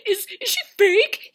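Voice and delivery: high, loud, stammering query; mock panicked question. Peter running around asking the women, mock panicked. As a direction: stammer it with rising alarm.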